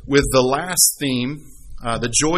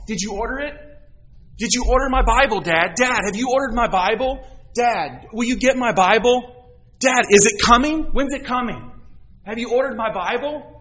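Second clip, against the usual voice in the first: put-on high, pleading kid voice, much higher; impatient child; 8 semitones higher than usual. Daughter pestering dad by phone about her Bible, high and impatient. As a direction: pester like an eager kid waiting.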